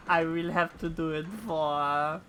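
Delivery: hammed-up French accent. Gamer rehearsing accent for Heavensward characters, mock French accent. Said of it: "every single N P C has that accent".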